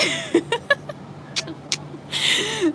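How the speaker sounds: real laugh; quick laugh. Real, not acted. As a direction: genuine laugh. Laughing mid-story.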